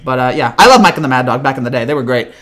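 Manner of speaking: usual speaking voice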